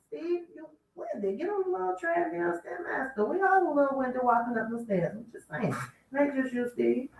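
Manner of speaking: high teasing sing-song. Real, not acted. Host teasing Steve about stairs, high and playful.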